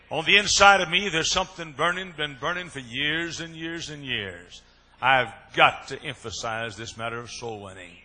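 preacher to his people about the burning call, loud and high; full-force preaching. Acted. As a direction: preach it with fire.

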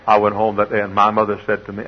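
normal talking voice

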